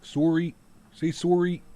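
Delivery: loud, high Canadian "soory"; exaggerated Canadian accent on sorry. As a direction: punch up the Canadian round o in sorry.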